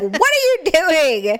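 loud, high scolding yell; shouting. Retold or imitated. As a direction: yell at the kid. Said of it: "Then I'd be like".

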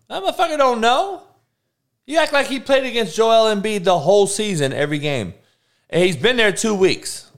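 very high, loud indignant rant; ranting that he can't know; incredulous rant. Real, not acted. Ranting at Harden.

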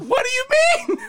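very high, disbelieving outburst; incredulous squeal. Real, not acted. Co-host disputing his denial of the accent, incredulous squeal. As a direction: shoot the voice way up in disbelief.

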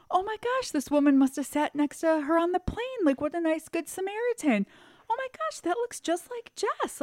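high-pitched, wondering voice; imitated amazement. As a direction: act out dawning recognition. Aunt's dawning surprise, acted out.

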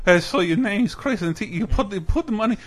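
put-on high-pitched generic foreign accent; [name]'s immigrant character. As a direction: imitate a call agent with a comedy immigrant accent. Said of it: "exactly like [name]'s impression of, like, generic immigrant character".

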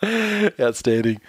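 stretched out and delighted; drawn-out delight. Real, not acted. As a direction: savour the word slowly. Delighted reaction to the tweet.

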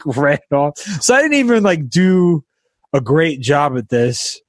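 ordinary talk, nothing marked

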